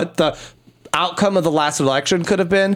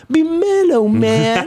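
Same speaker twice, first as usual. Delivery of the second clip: laid-back hippie; 6 semitones higher, much slower than usual. Pretend. Reciting a hippie rhyme.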